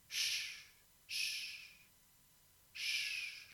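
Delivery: soft unvoiced shushing; calm him with a hush; a quiet soothing shh. Hushing crying Lars.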